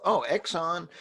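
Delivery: usual speaking voice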